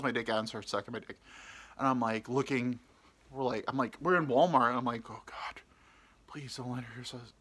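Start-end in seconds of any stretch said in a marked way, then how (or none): 5.1-7.3 hushed, anxious pleading; acted nervous whisper; whispered prayer. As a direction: whisper a desperate little prayer. Silent prayer in fear.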